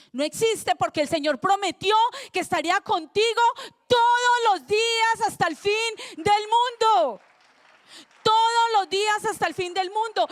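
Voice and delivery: loud, high, fervent sermon delivery; preaching at full force. Acted. Pastor proclaiming God's promise to the church, loud fervent preaching. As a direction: proclaim it to the whole congregation.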